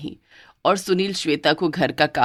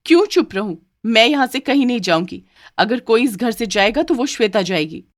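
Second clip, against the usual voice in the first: defiant wife; 4 semitones higher than usual. Acted. Wife defies husband.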